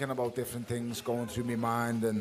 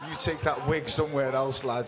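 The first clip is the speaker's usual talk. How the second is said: louder mocking jibe broken by laughter; real amused taunt; laughing jeer; 3 semitones higher, 6 dB louder than usual. Real, not acted. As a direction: send him off with a laugh. Jeering at heckler's wig.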